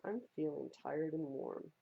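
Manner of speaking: plain reading voice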